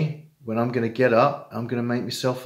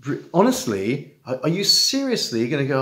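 usual speaking voice

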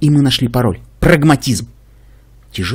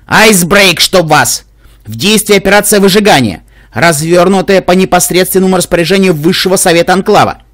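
loud, higher, furious reprimand; angry officer; 4 semitones higher, 5 dB louder than usual. Acted. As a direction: scold her colleague in anger. Разгневанная командующая по радио. (разгневанный офицер)

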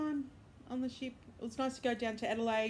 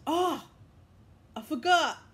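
high, loud sudden recollection; sudden surprise; 8 semitones higher, 11 dB louder, slower than usual. Real, not acted. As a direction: blurt it out as it hits you. Suddenly remembers something.

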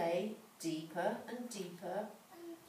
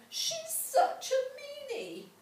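put-on little girl voice; 5 dB louder than usual. A narrator's reading. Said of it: "Gretel said".

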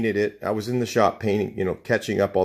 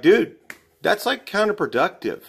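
scoffing retort; 9 semitones higher than usual. Retold or imitated. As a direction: tell him it's pointless, amused. Scoffing at a coworker's method.

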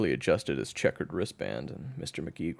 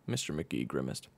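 plain reading voice

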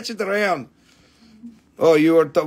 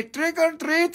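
higher, light imitation of a child at the door; kid voice; 9 semitones higher than usual. Seller imitating children at the doorbell in a higher kid voice. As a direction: chirp it like a kid on Halloween. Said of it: "The kids can ring the doorbell and say".

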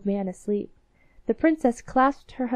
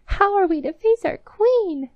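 despairing mice; 10 semitones higher, 7 dB louder than usual. Acted. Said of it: "said the three mice".

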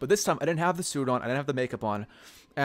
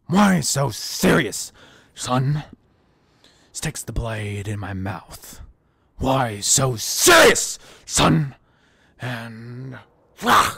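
very slow menacing villain, bursting loud; deranged villain drawing out each phrase; Heath Ledger's Joker; 5 dB louder, much slower than usual. Acted. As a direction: taunt slowly, spiking on the key word. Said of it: "done that Joker impression".

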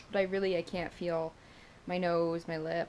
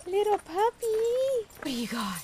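excited puppy voice; 13 semitones higher than usual. Real, not acted. Greets the dog.

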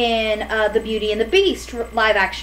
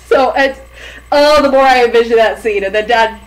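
ordinary talk, nothing marked

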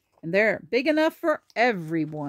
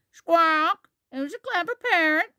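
high squawking parrot voice; Polly; 9 semitones higher, 6 dB louder than usual. Acted. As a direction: squawk loudly, then parrot the words back high. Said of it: "Polly is very good at repeating".

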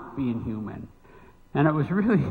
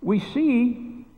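preaching; 5 semitones higher than usual. Acted. Preaching builds in pitch.

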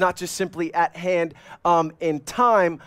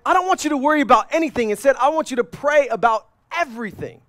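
urgent quote; 6 semitones higher than usual. Retold or imitated. Paul voiced urgently.

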